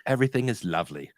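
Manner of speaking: lightly put-on British version; quick, lightly imitated British accent. Imagining a UK-made Lego Movie, light mock British accent. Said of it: "was made in the U K".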